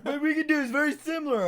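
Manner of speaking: high, loud put-on voice; Ronnie. Friend insisting on film idea, high loud imitation.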